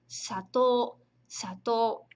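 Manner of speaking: teacher models "sato" as spoken, long o; everyday pronunciation. Shown as a demonstration. Teacher showing students how "sato" really sounds. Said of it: "na pronúncia".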